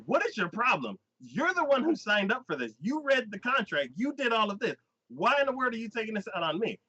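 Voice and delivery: high-pitched retold telling-off; mock indignant rebuke; arguing. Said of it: "They just start arguing".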